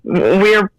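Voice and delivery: ordinary talk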